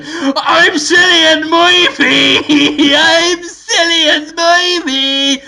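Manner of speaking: high, loud, slow silly cry; gleeful hammed-up squeal with a giggle between; Cillian Murphy. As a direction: proclaim the name in a daft stretched voice. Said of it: "and he said".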